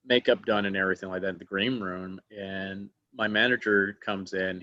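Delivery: usual speaking voice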